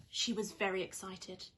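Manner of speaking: plain reading voice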